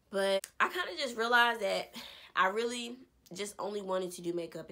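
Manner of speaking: usual speaking voice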